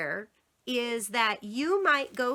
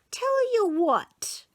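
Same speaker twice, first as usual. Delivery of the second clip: put-on sly bargaining child; 10 semitones higher, 3 dB louder, slower than usual.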